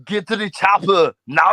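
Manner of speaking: mock Austrian strongman; Arnie. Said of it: "can you do the audio impression?".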